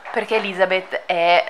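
normal talking voice

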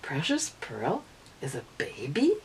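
plain reading voice

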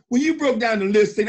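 ordinary delivery

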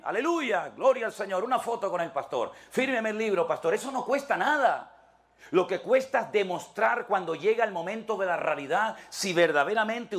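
full-force sermon delivery; fiery preaching. Acted. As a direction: preach it to the back pews. Preaching climax.

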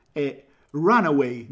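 Runaway said slowly in English; English pronunciation. Shown as a demonstration. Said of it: "dici in inglese?".

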